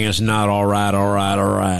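a hint of McConaughey's lazy catchphrase; McConaughey impression. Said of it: "Matthew McConaughey".

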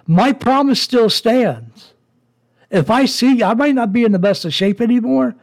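tearing into him, voice climbing high; furious rant. Real, not acted. Ranting at the man who betrayed his father, furious.

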